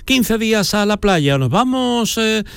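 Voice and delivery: high, light mock voice of a carefree caller; imitating the caller. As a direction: tease him by playing him announcing trips. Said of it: "Yo no te escucho".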